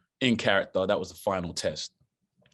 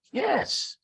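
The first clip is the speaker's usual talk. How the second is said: shouting back on the phone. Retold or imitated. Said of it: "I'm like".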